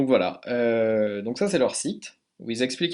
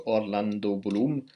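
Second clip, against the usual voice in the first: Orlando Bloom read the Russian way, a touch slower; Russian accent; 2 semitones lower, slower than usual. Shown as a demonstration. Showing learners how the name sounds in a Russian accent. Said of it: "avec un accent russe".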